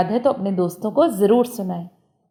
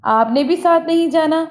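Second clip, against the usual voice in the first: wrong sentence 'आपने भी साथ नहीं जाना' said higher, with a lift on 'साथ'; wrong example set apart; 6 semitones higher than usual. Shown as a demonstration. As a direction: pitch up to flag the mistake. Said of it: "गलतियों को समझें". (गलत जुमला, ऊँची आवाज़ में)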